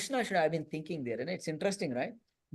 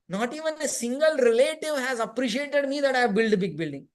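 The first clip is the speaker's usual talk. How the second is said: higher and louder, imitating a crying woman's grievance; mock tearful complaint; 7 semitones higher, 5 dB louder than usual. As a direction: whine it like a hurt relative. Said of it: "That is her cry".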